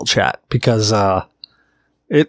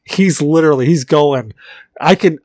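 normal talking voice

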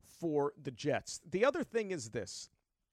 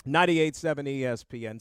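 radio announcer; 8 dB louder than usual. Acted. Radio station ID.

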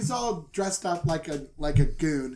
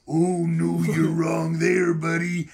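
Macho Man voice; 4 dB louder, slower than usual. Retold or imitated.

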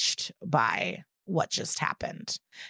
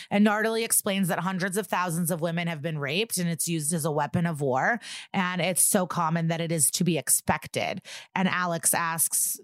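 normal talking voice

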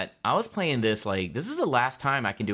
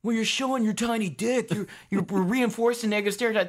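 much higher, sing-song mock of the critics; 11 semitones higher than usual. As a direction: parody the scolding critics, pitched up. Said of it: "they're like".

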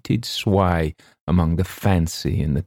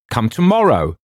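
loud, raised bark falling at the end; 6 semitones higher, 5 dB louder than usual. A narrator's reading. Wilde silences the visitor at the door, loud falling bark. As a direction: cut them off with a firm, high bark. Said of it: "a sort of bark from Mister Wilde".

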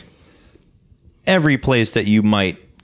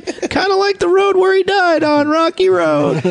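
very high, slow mock holler; screaming deaf neighbor; slower than usual. Guest as deaf neighbor at the ice cream shop, high mock holler. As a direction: parody the deaf man's shrieked small talk. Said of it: "he just screams from all that tractor work".

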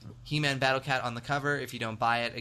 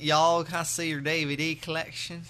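mock Southern accent; 4 semitones higher, 3 dB louder than usual. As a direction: put on a joking Southern accent, laughing. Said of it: "Be like".

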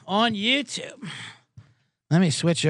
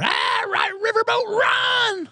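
high, excited cheering shout; mock cheer; much slower than usual. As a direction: yell it like a fan in the stands. Cheering for coach Rivera.

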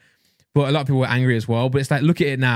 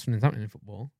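softer, northern-sounding retold remark; northern English accent; 4 semitones lower, 9 dB quieter, much faster than usual. [name] reporting the match news, northern English accent. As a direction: say it quietly with a northern lilt. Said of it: "That sounded so northern".

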